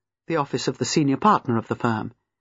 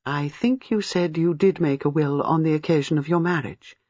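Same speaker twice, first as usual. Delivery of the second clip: ordinary delivery, nothing marked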